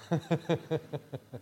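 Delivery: soft chuckle. Real, not acted. Preacher amused by the warm-shoes line, soft chuckle. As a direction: chuckle quietly at the punchline. Chuckle after funny quoted remark.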